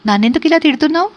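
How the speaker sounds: high, fast, provoking accusation; quick, high and picky, spoiling for a fight; picking a quarrel. A narrator's reading. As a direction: snap it out to start a fight on purpose. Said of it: "తగాదా కావాలని కల్పించుకుంటూ".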